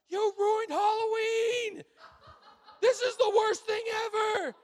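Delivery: mock-hysterical high scream, stretched words; child's screaming meltdown. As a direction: imitate a kid's tantrum at full volume, high and drawn out. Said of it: "and he yells".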